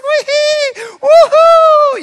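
put-on high, loud gleeful squeals of a child; happy little girl squeal. Pastor imitates little girl squealing as she bounces in the pool. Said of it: "she's like".

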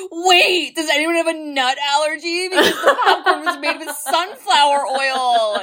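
high, fast, fretful self-quote; high anxious voice. Retold or imitated. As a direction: play your own worried self. Retelling own anxious question.

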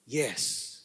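low, soft "yes"; quiet submission. Acted. Preacher answers despite reluctant flesh, quietly submitting with "yes". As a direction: drop "yes" down softly, giving in.

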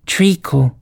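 high, sleepy little voice. A narrator's reading. Dormouse interrupts from behind the cook, in a sleepy voice. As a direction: pipe up as if half asleep. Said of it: "said a sleepy voice behind her".

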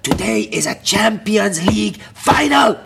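loud, high, drawn-out rallying cry; hyped-up manager's shout. Retold or imitated. Manager rallying his players before an ordinary game, hyped-up shout. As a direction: bellow it slowly like a big announcement. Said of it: "he'd come in with the days".

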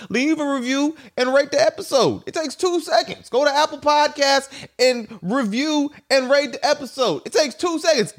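high, eager plea; pleading for a review. Pretend. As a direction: beg the listeners sweetly. Begging for a rating.

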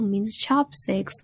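ordinary talk, nothing marked

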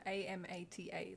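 A M A T A letter by letter, slowly; quiet, slow spelling of A M A T A; spelled out. Shown as a demonstration. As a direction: softly spell A M A T A, one letter at a time. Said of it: "I know the accent throws you off".

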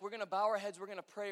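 normal talking voice

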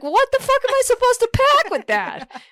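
high, loud exasperated outburst; exasperated high shout. Retold or imitated. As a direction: freak out in a high voice. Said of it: "I was like".

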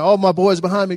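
normal talking voice

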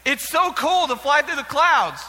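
high, loud exclamation; mock-outraged exhortation. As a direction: urge the crowd in playful disbelief. Mock disbelief at the audience.

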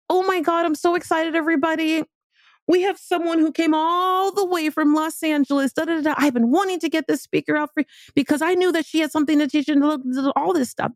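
high, bubbly hostess voice; imitating the excited host. Organizer introducing the speaker to the room, high and gushing. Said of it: "she's like".

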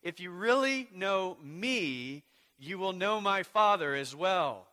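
rising sermon climax; building proclamation. Acted. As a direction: preach it upward. Reading, building volume.

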